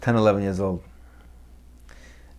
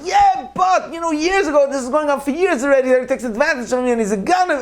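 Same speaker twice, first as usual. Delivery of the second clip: high, complaining whine; aggrieved brother. Retold or imitated. Resentful brother griping to the rabbi about his sibling, high and aggrieved. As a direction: air an old grudge in a high voice.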